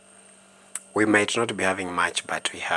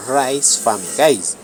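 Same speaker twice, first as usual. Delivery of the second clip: excited; 6 semitones higher, 10 dB louder, slower than usual. Real, not acted. Enthusiastic pitch for Tanzanian rice.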